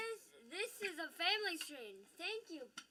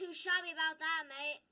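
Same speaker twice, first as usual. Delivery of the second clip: chummy put-on English accent; playful English accent; British accent; 6 dB louder than usual. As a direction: play the British mate, projecting a little. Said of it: "My British accent".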